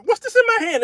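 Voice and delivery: high astonished squeal; mock amazement. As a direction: sound comically amazed. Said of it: "I said".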